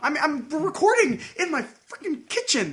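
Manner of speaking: high-pitched protest; real exasperation. Real, not acted. Arguing with co-host while recording in the kitchen, exasperated.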